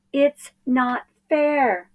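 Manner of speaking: high, slow sulky whine; whiny complaint. Acted. Boy sinks into the sea, whining high and slow. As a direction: stretch it out like a sulking kid.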